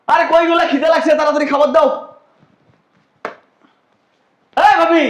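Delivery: loud, high hungry yelling; demanding yell. Acted. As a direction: holler for food like a spoilt brat. Son yelling for food. (ক্ষুধার্ত হয়ে চিৎকার)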